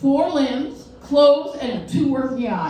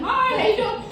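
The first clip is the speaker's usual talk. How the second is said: cheery greeting; 8 semitones higher than usual. Retold or imitated. Waving at silent neighbors.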